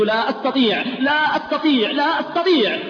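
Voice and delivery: escalating cries of helplessness; rising anguished refusal. Retold or imitated. Dying man refusing.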